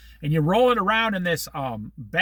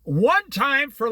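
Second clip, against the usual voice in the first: ordinary delivery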